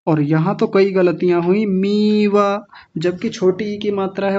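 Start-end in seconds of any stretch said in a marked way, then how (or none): 1.7-2.7 मीवा with a hugely long ee; needlessly stretched. Retold or imitated. Teacher mimicking students' errors, overlong ee in मीवा. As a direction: drag the first vowel of मीवा out slowly. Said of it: "बिना बात के लंबा खींच".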